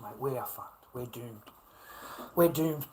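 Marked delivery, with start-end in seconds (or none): 2.3-2.9 ranting repeat to the camera; louder repeat. Real, not acted. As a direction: hammer the repeat home. Gloomy repeated rant line.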